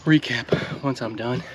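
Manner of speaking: winded, words between breaths. Real, not acted. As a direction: talk while still catching your breath. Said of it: "once I'm done and can breathe again".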